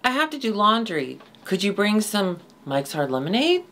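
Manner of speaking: put-on high, childish decoy voice; bait kid voice. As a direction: play a naive kid online bait. Bait kid voice for predator sting.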